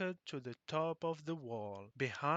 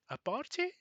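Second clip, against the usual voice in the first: high surprised "party?"; surprised question; 12 semitones higher, 3 dB louder, faster than usual. A narrator's reading.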